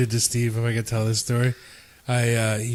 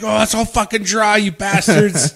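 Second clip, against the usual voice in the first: high-pitched mock complaint; yelling and swearing; 7 semitones higher, 3 dB louder than usual. Victim scraping dried dip off glasses, imitated angry swearing. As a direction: complain in exasperation as the pranked victim. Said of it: "he's, like, yelling and swearing at us".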